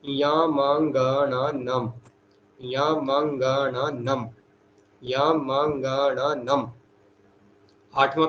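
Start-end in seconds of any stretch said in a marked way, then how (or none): 0.0-6.7 यामांगणानम spaced out syllable by syllable; slow, careful chant; slow recitation. Shown as a demonstration. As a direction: say it slowly, like dictation to a class. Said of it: "सातवां प्रत्याहार कौन सा है?".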